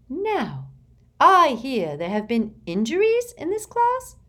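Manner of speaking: high, sugary inquiring voice; sweet, probing high tone; Umbridge voice. A narrator's reading. Umbridge's sugary questioning of class.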